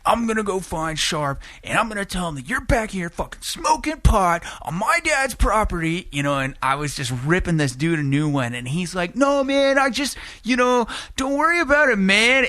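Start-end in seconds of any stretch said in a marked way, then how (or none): 0.1-6.1 put-on high teen threat; a teen threatening to tell on him; high mock tattletale threat; bratty threat. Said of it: "I'm just like". 9.2-12.4 high, loud mellow stoner voice; Big Lebowski. Retold or imitated. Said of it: "Big Lebowski, the way he's talking to me".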